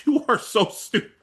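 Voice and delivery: high-pitched, laughing exasperation; laughing at the silliness of it; amused laughing. Real, not acted. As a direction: say it through a laugh. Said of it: "he's making me laugh now".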